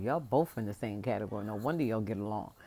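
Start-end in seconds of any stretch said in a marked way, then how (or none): none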